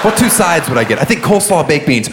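ordinary talk

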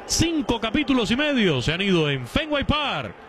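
play-by-play recap. Real, not acted. Announcer closing the top of the sixth for listeners, play-by-play recap. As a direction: wrap up the inning with lifted pitch. Sums up the inning on air.

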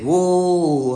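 a showman's whoa, held out and falling; singer's call. Retold or imitated. Speedo puts the mic to her, a drawn-out showy whoa. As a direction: hold the whoa out like a stage singer, then let it drop.

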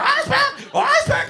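put-on very high chanting toddler demand; whiny little girl. Teacher mimics the girl chanting for an ice pack, very high. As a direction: chant it like a spoiled preschooler. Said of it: "She's like".

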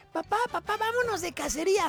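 put-on very high-pitched child voice; little boy. Boy to his dad about hunting, high put-on child voice. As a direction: pipe up like a kid pleading with dad. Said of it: "está un niño que le dice a su papá".

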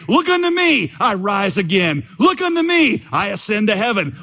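chanting refrain, leaping high on each repeated phrase; hammed-up country preacher building a high, insistent refrain; impassioned preacher. Preacher pleading with poor sinners from the pulpit, impassioned and high. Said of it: "followed up his text in this way".

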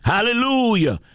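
ordinary talk, nothing marked

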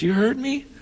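high-pitched imitated disbelief; surprised question. Patient surprised her pastor heard her, high-pitched question. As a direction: ask it high, taken aback. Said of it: "She goes".

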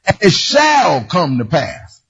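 slow, solemn "shall come to pass"; scripture reading. Acted. Preacher reads the verse to the congregation. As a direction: read it like a promise.

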